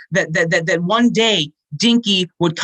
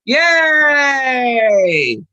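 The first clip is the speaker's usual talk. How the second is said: cheering; 6 semitones higher than usual. Real, not acted. Cheering end of scene.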